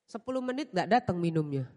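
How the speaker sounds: ordinary talk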